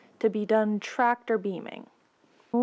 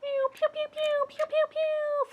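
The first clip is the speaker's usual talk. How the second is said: high whispered pew noises; whispered laser sounds. Retold or imitated.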